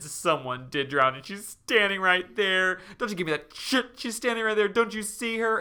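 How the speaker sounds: high, loud, slowing insistence; Sarah insisting to Richard the ghost is there, high and loud; mock hysterical insistence. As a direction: insist she is right there. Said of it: "and she said".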